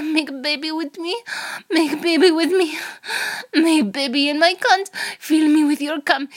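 put-on high, loud, urgent Russian accent; heated, moaning Russian-accented pleading. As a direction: build to breathless demands in a Russian accent. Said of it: "in a Russian accent".

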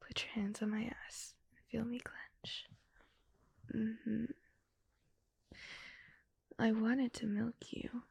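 hushed, breathy seductive whisper; intimate whisper.

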